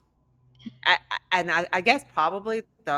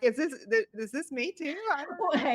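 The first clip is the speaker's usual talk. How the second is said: high, unsure, wondering; unsure kids; 10 semitones higher, 4 dB quieter than usual. Retold or imitated. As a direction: wonder aloud in a higher voice.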